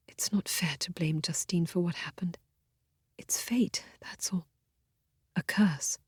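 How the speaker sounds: barely voiced, fading to nothing; sick woman whispering her resignation; weak whisper. A narrator's reading. Feeble voice from sickbed.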